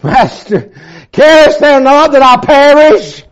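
high, loud, anguished appeal; loud quoted cry of despair; desperate cry. Retold or imitated. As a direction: cry out to the Master in fear. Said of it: "I cried".

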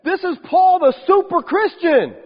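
high, loud, starry-eyed gush; half-mocking wide-eyed awe; awed mock believer. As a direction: gush like a dazzled fan. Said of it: "you think".

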